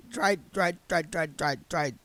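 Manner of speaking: repeated stuck stutter, soft. Acted. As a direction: stutter over the word. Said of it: "stuttered the Burzr".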